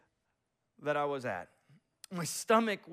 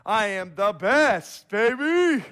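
loud, high, gloating declaration; cocky shout of victory, higher and louder; triumphant inner boast; 6 semitones higher, 12 dB louder than usual. Retold or imitated. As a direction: crow it like a champion, stretching the best. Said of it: "This is what I thought".

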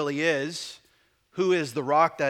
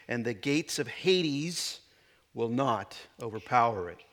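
measured, solemn reading of the Gospel; scripture reading; 2 semitones lower, 4 dB louder than usual. Acted. Reads scripture passage aloud.

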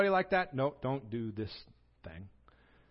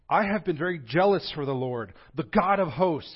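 louder, higher griping; accusing complaint; 5 semitones higher, 9 dB louder than usual. Retold or imitated. Elijah complains to God, loud and aggrieved. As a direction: protest his loyalty at God. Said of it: "practically accusing God here".

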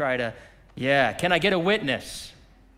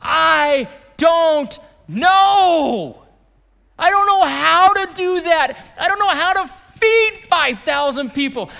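despairing shout; 11 semitones higher, 10 dB louder than usual. Acted. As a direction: bellow the helplessness from the pulpit. Preacher acting out helpless believers.

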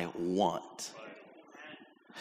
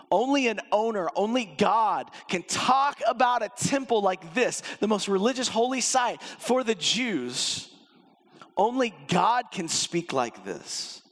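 preaching shout; 7 semitones higher than usual. Real, not acted. Pastor proclaiming to the congregation that only God speaks so, shouting. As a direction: declare it to the whole church. Preacher climaxes on God's authority.

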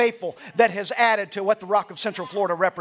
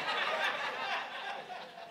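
breathy laugh; 14 semitones higher, 11 dB quieter than usual. Real, not acted. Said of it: "then I felt like an idiot".

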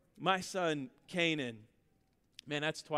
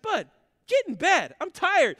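exasperated dad; 7 semitones higher, 8 dB louder than usual. Retold or imitated. Dad orders son back to bed.

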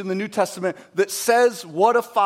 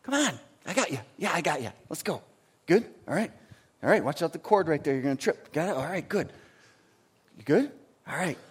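put-on soft, hushed encouragement to a small child; father guiding his child softly past a cord; gentle coaxing father; 5 dB quieter, faster than usual. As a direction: coax a little one along quietly. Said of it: "you see a father going".

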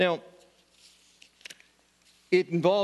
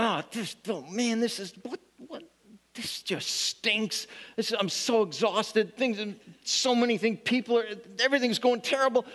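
higher, weary mock whining; mock grumbling; 6 semitones higher than usual. Believer complaining about life, mock-weary whine. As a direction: sigh out a lightly hammed-up complaint. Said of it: "we grumble".

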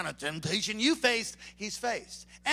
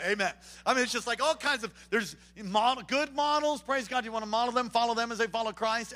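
ordinary talk, nothing marked

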